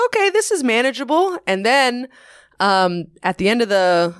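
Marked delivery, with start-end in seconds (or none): 0.1-1.4 surprised relief. Retold or imitated. Retold relief at seminar.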